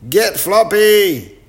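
high, slow, loud yell. Acted. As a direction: shout it across the cave, high and drawn out. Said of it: "yelled Chip".

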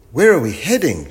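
put-on loud, high child's question; shouted question. A narrator's reading. Chip questions the professor, shouting high. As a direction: call the question out. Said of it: "shouted Chip".

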